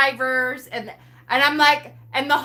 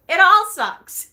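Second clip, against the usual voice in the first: breezy resignation; 7 semitones higher, 6 dB louder than usual. Retold or imitated. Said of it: "it totally was like".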